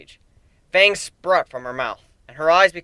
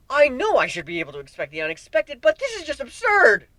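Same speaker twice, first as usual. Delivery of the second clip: panicking; 8 semitones higher than usual. A narrator's reading. Narrator panics about chaos.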